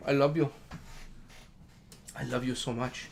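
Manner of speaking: soft, hushed loving murmur; tender to dog. Real, not acted. Owner hugging Frank, soft hushed coo. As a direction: coo it close to his ear.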